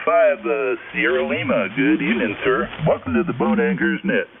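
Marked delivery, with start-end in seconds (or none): none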